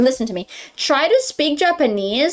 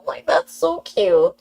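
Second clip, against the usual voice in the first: ordinary delivery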